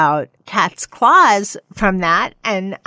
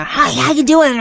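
rough-play greeting; 6 semitones higher, 4 dB louder than usual. Retold or imitated. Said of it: "imagine the".

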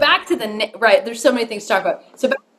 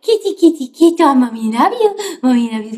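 pet voice; 3 semitones higher, 3 dB louder than usual. Retold or imitated. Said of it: "It's when your voice gets deeper".

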